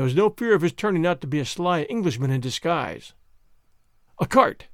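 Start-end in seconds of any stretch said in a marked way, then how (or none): 4.2-4.7 high, loud breathless shout. Acted. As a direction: call out, breathless from running. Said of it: "he shouted breathlessly".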